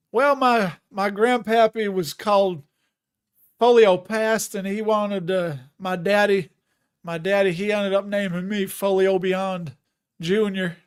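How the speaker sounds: loud, hammed-up Southern storyteller; mock Southern yarn. Streamer inventing a grandpappy behind the name, mock Southern accent. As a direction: spin a tall family tale in broad Southern.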